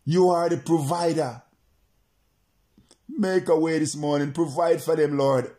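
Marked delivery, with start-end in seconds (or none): none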